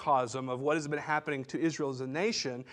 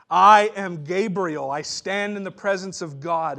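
louder, slow and mighty proclamation; booming angel voice; 3 semitones higher, 9 dB louder, slower than usual. Retold or imitated. Gabriel answering the doubting priest, booming. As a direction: boom it out like an angel. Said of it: "I see him saying".

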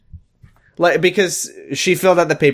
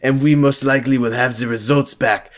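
put-on French accent. Doctor gives Amy her test result plans in a French accent. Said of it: "In an outstanding French accent".

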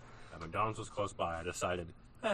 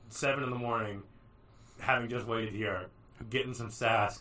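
ordinary delivery

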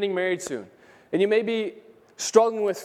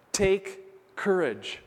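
slow reassurance; 3 dB quieter, much slower than usual. Acted. Jesus comforting the fearful woman.

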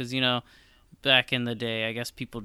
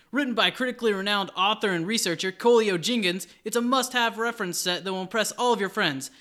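high, loud infomercial pitch; hard sell ad; 9 semitones higher, 6 dB louder than usual. Acted. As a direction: sell it like a late night ad. Mock infomercial intro.

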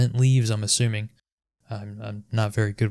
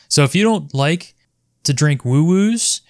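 normal talking voice

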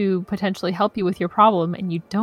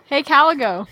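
louder call to the god; mock calling out; 5 semitones higher, 4 dB louder than usual. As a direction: call out to the heavens, half-joking.

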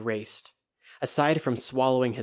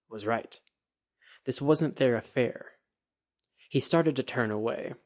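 plain reading voice

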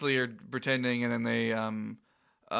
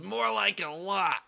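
Donald voice; 7 semitones higher, slower than usual. Retold or imitated. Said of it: "Donald says".

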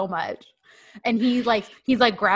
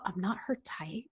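whispered under the breath; muttering to himself; 12 dB quieter than usual. Retold or imitated. Host retells the jealous man muttering to himself, whispered. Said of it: "muttering to himself".

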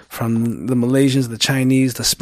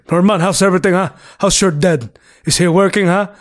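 Filipino uncle voice; 4 semitones higher than usual. Retold or imitated. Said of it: "you'll have an uncle. Every uncle ... they'll always ask".

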